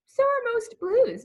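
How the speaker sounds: light character voice; friendly reply. Acted. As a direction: answer back with a grin. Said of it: "Midnight Blue said".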